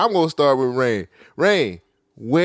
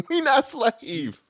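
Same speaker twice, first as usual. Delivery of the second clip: laughing; 12 semitones higher, much slower than usual. Real, not acted. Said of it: "laughing while repeating".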